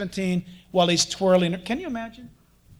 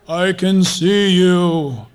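very slow, loud, hammed-up divine voice; God; 3 semitones lower, 6 dB louder, much slower than usual. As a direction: boom it out like a TV deity watching from above. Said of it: "he doesn't talk like that".

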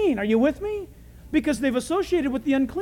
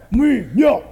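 loud, mock caveman grunt; guy grunting; 8 dB louder than usual. Joking that it's a guy thing, grunting loudly. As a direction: grunt it out like a brute. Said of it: "It's a guy thing".

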